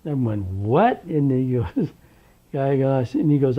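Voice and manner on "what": high, loud startled question; shocked disbelief. Retold or imitated. Young director just summoned to court, shocked. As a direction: re-enact the shock, pitch shooting up.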